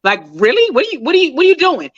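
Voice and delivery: retold exasperated snap, rising high at the end; fed up, demanding what she's doing; a mock exasperated husband brushing his wife off. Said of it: "He was like".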